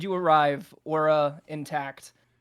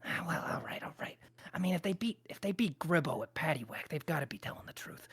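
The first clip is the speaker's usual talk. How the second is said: very soft, half-whispered aside; muttered aside; 12 dB quieter, faster than usual. Acted. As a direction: think it over under your breath. Boss thinks aloud to himself.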